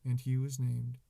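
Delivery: loud, projected scripture reading; Bible reading. Acted. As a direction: read scripture out to a room.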